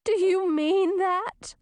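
slow, high, tearful question; high, emotional princess; through tears. A narrator's reading. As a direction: ask hopefully through mild tears. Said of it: "asked through her tears".

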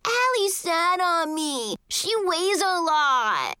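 high, drawn-out tattling whine; fake crying. A narrator's reading. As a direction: wail slowly to a grown-up, pretending to cry. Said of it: "he started fake crying".

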